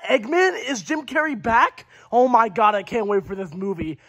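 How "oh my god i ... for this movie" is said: normal talking voice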